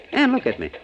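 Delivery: jumps up high in a startled snap; sudden alarm. Acted. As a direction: break off the romance, suddenly alert.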